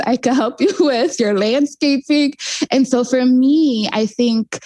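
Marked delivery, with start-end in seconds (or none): 0.0-2.3 laughing through the quote. Real, not acted. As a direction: talk while giggling. Laughing while quoting neighbor.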